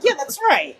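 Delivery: high, laughing yeah that's right; laughing self-agreement. Retold or imitated. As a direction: cheer yourself on through a laugh. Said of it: "I'm like".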